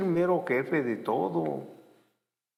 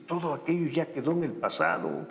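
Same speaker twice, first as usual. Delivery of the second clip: usual speaking voice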